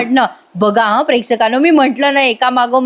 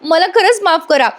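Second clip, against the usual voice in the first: higher-pitched mock tearful begging; imitated crying; 5 semitones higher than usual. [name] begging while being thrown out, mock crying. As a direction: plead as if in tears, no real sobs. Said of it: "Priya एवढी रडतीये".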